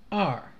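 "二" starting high and dropping quickly; short, sharp fall on "二"; falling fourth tone. Shown as a demonstration. Teacher marking tones on numbers, falling. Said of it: "fourth tone".

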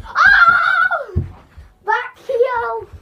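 high, loud laughing yell; excited shout. Real, not acted. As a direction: cheer a great back heel. Celebrating a trick goal.

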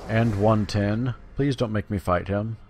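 ordinary delivery

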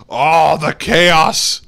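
loud, high, gleeful exclamation; theatrical glee. Pretend. Gamer enjoying the chaos, loud theatrical glee. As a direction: revel loudly in the mayhem.

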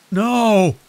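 high, loud terrified scream; desperate shouted no. A narrator's reading. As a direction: scream it as he fights back. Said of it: "Enclair screamed".

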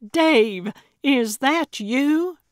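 high, loud, drawn-out astonished question; excited cry. A narrator's reading. Gertrude calling to her rescuer, crying out. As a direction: call out in amazed recognition. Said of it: "she cried".

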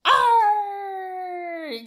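playful pirate arr. Shown as a demonstration. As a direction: growl it out together. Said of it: "say it with me".

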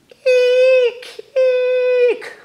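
very high, loud screech; eagle screech. Acted. Reading the eagle poem to kids, loud high eagle screech.

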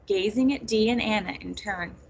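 plain reading voice

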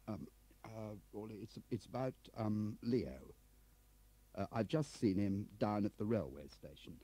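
put-on high, stammering, bumbling old chap; flustered Crabbe figure. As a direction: hesitate and fumble through the news.

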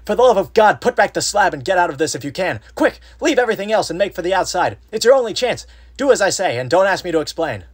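frantic high-pitched shouting; wild consternation. A narrator's reading. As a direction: shout a desperate warning, high and very loud. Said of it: "in a pitch of wilder consternation".

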